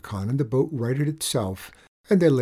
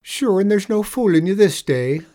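sighing leprechaun; 8 semitones higher, 6 dB louder than usual. Acted. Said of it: "sighs the leprechaun".

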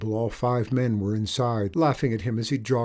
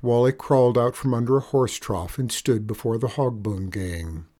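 usual speaking voice

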